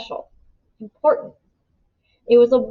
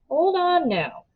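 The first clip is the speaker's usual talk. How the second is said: cautious; 7 semitones higher than usual. A narrator's reading. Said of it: "said Miles cautiously".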